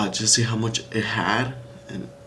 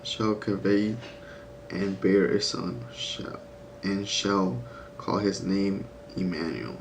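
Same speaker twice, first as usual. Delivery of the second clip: reading scripture; slower than usual. Acted. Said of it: "The Bible says".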